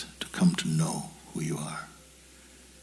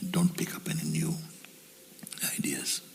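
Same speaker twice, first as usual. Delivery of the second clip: guided meditation; 5 dB quieter than usual. Acted. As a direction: let each word sink in softly. Guides listeners to empty the mind.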